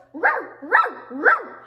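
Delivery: imitated puppy barking in loud bursts; squeaky puppy bark. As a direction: yap sharply like a tiny dog. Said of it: "tiny, squeaky voice".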